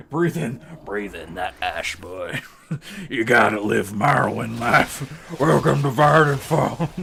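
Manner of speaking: strained raspy dark elf; hammed-up Morrowind dark elf. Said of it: "I can't do that. It actually hurts".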